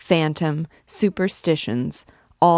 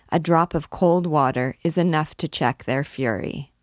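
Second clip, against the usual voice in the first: plain reading voice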